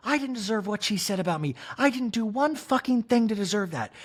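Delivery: a touch of wounded pride; Kyle impression. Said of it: "And Kyle's like".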